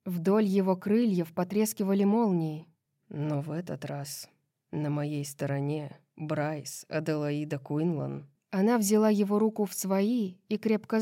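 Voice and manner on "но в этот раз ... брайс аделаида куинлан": low, soft voice of a husband; quiet, low and hoarsely earnest. A narrator's reading. Husband to Bryce at his side, hoarsely earnest. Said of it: "хрипло произнёс он".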